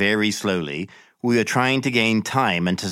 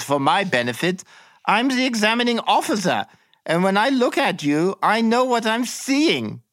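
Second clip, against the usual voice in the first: much higher, chatty mock-friendly officer voice; silly chatty interrogator; 10 semitones higher than usual. Examining officer coaxing the prisoner to confess, high chatty voice. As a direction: coax him like a falsely friendly examiner. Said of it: "He gave an angry laugh".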